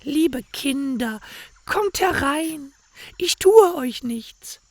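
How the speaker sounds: very high, slow, falsely kind crone voice; sweet-talking old witch. A narrator's reading. Witch coaxes the children inside, high and falsely sweet. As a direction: lure the children in with sugary menace. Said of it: "ist zu nett zu ihnen und sagt".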